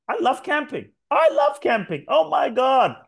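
high, gushing, over-eager; love bombing. Retold or imitated. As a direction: gush like you've found your soulmate.

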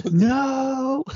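high, drawn-out cry of no; a high wail of refusal; horrified no. Retold or imitated. Elaine stuck in the cab with Putty, a high wailing no. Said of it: "She's like".

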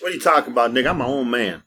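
ordinary delivery

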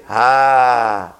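relieved sigh. Retold or imitated. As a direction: breathe out with satisfaction. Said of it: "first you say".

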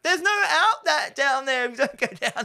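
laughing warning shouted at a horror movie character; laughing plea. Real, not acted. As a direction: beg the character through laughter. Yelling at the screen, laughing.

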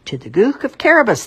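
high, loud chorus of farmers; farmers answering together. A narrator's reading. Farmers answering the king together, loud. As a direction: answer as a crowd in unison. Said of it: "the farmers all answered together".

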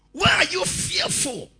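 high, loud "Why are you fearful?"; loud rebuke. Acted. Preacher pressing Jesus' question on the congregation, loud rebuke. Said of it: "preacher repeats Jesus' question".

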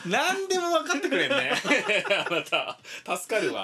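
laughing, teasing praise. Real, not acted. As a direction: tease him through laughter.

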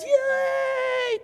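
put-on very high old lady squeal of joy; high mock granny cheer. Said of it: "she goes".

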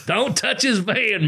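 high, slow, drawn-out don't touch his van; slow each word out like a stern warning; playful slow warning; mock warning. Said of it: "Tell him".

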